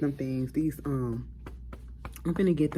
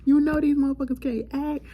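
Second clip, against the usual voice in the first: high exasperated plea. Retold or imitated. Scolding Natalie for bringing the cast, high exasperated plea. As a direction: plead with her, half laughing, half crying. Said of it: "I said".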